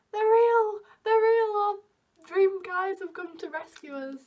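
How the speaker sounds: high, loud, giddy squeal; giddy fangirl shriek; excited squeal. Retold or imitated. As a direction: squeal like a starstruck teen. Girls excited seeing their dream boys.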